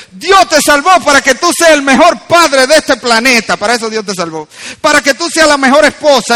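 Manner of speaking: loud, high exhortation; forceful preaching. Real, not acted. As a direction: urge the congregation with full conviction.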